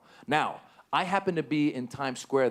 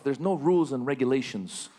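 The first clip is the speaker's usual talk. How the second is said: ordinary talk, nothing marked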